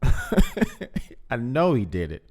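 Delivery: slow, incredulous laugh through the words; laughing in disbelief. Real, not acted. Host laughing while condemning R. Kelly, slow laughing disbelief.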